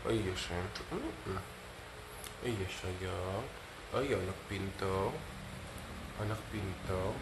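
quiet, low tender cooing to the dog; hushed loving coo; soft cooing. Real, not acted. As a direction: murmur sweetly to the dog.

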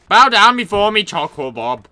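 loud imperious order; commanding. Acted. Master orders Charcoal Bob to bow, loud. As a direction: command him to kneel.

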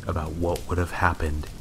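ordinary delivery

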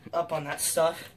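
usual speaking voice

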